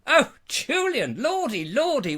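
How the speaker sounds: high, drawn-out hammed-up delight; mock rapture. Host hailing Julian's arrival, mock rapture. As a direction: swoop up as if overcome.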